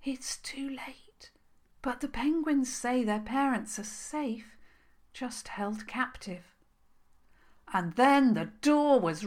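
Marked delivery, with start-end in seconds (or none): 0.0-6.5 hushed, breathy hare whisper close to the ear; snuffled whisper. A narrator's reading. Hare whispering a warning into the princess's ear, hushed. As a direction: whisper urgently into her ear. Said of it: "snuffled into her ear".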